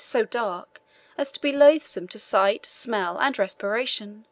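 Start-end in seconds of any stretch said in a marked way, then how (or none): none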